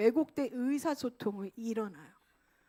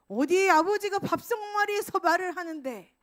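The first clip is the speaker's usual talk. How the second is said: loud, higher, rebuking father; stern father scolding; 6 semitones higher, 7 dB louder than usual. Retold or imitated. An authoritarian father rebuking a child at the table, stern and loud. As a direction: rebuke the child who dares talk at dinner.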